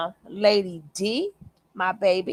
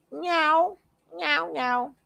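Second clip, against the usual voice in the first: imitated cat meow, higher-pitched; 7 semitones higher, slower than usual. Streamer mimics the cat during the live show, meowing. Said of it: "my cat said".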